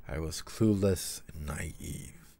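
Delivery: slow reading voice that sinks to a hush by the end; slow, hushed storybook reading; story reading. Acted. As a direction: read it gently and let it fade nearly to a whisper. Reading the story passage aloud.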